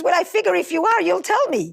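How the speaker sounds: acted mild exasperation, justifying himself; defensive husband. Husband answering wife in the car, acted defensiveness.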